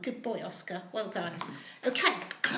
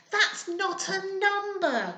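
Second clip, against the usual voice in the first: put-on a slow, higher little-girl complaint; mild complaint; 7 semitones higher than usual. A narrator's reading. Spider girl to her mum, a touch of a complaint. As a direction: whine it gently, unimpressed. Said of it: "complained Spinderella".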